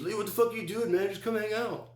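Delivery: very high mock-friend voice; high, strained caricature of a pal; friend calling in. Zach at the car window.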